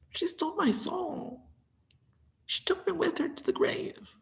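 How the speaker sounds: high, soft voiceless lament; whispering grief over the stolen soul; despairing whisper. Real, not acted. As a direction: whisper it like a haunted confession. Whispered lament about lost soul.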